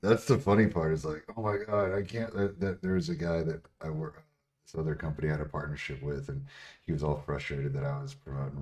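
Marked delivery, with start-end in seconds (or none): none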